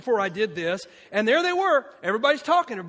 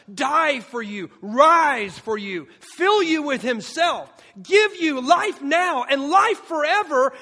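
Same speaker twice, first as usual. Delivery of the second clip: loud, high, slow impassioned preaching; preaching at full force; 8 semitones higher, 8 dB louder, slower than usual. Acted. Pastor pleading with his congregation, slow loud preaching. Peak of the sermon.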